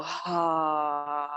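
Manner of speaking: long, open-mouthed exhaling sigh; dramatic sigh. Shown as a demonstration. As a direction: let a big breath fall out on ah. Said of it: "open your mouth and just big sigh".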